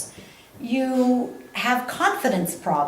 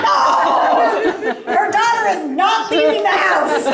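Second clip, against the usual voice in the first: high, loud mock panic of a mother; freaked-out mother; 9 semitones higher, 4 dB louder, faster than usual. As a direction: play the horrified parent shouting no. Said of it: "she's freaking out".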